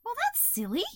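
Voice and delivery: high, light caterpillar voice; reassuring Hazel. A narrator's reading. Hazel cheering up the toad, reassuring. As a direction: lift the pitch to brush it off kindly.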